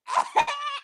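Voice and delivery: short, high imitation laugh; demonstrated signature laugh. Shown as a demonstration. Fan showing Craig which laugh she means, high imitation. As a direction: give a quick high sample of their laugh. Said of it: "let's do that laugh".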